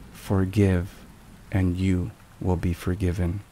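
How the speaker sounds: usual speaking voice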